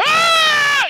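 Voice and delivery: sudden piercing mock scream; Bobcat scream. Imitating the comedian's famous scream. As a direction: let out a wild comedian's yell. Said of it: "He's like".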